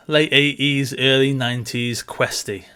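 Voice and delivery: normal talking voice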